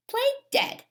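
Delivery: normal talking voice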